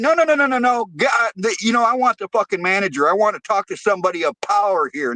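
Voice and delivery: retold outrage. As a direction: demand the manager, mock-outraged. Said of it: "And I'm like".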